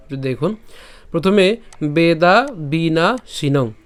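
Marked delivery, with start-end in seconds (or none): 1.8-3.7 slow, word-by-word Sanskrit model; pronunciation demo. Shown as a demonstration. Teacher starts the verse from the beginning, slow demonstration. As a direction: say Vedha Veena শিনং in separate, drawn-out words. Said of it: "প্রথম থেকে যদি একটু খেয়াল করি".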